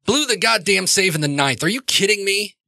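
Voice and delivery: high-pitched outraged complaint; exasperated rant. Acted. Father walks out after the blown save, exasperated rant. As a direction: rail in disbelief at your team. Father rants about lost game.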